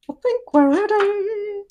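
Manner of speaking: high, slow, playful voice; sing-song put-on voice. Shown as a demonstration. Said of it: "repeats in a put-on voice".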